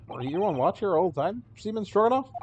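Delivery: ordinary talk, nothing marked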